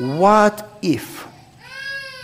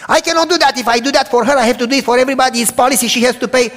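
higher, louder imitation of a stubborn landlord; mock indignant landlord; 8 semitones higher, 6 dB louder, faster than usual. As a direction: play the man refusing, pitched up and loud. Landlord refuses, retold confrontation.

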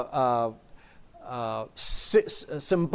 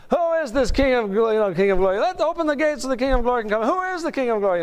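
high, carrying herald's challenge at the gates; ceremonial proclamation; 6 semitones higher than usual. Acted. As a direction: call it out with pomp. Acting out ceremony at heaven's gates.

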